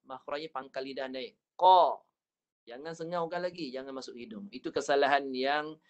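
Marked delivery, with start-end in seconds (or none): 1.5-2.0 qa kept in the mouth, crisp and loud; clear oral qa. Shown as a demonstration. Teacher shows the correct Qa in the mouth. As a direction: say qa from the mouth, not the nose. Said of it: "Keluar kat mulut".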